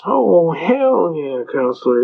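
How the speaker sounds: slow, drawn-out flippant "hell yeah"; mock flippant judge. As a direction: toss it off like a bored judge. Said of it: "kinda flippant".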